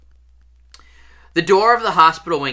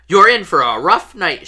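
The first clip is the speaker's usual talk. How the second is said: stern warning; 5 semitones higher, 7 dB louder than usual. A narrator's reading. Madame Pomfrey warns Harry.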